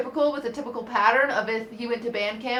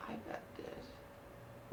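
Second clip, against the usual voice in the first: slow teasing whisper; 19 dB quieter, much slower than usual. Real, not acted. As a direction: whisper it slyly, slowly. Teasing aside.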